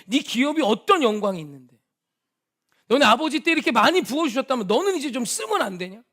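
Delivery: loud, high, heated yelling at a friend; retold yelling. Pastor retelling yelling at his rich friend, loud and high. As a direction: yell the question at him, building hotter. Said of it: "제가 막 소리를 질렀어요".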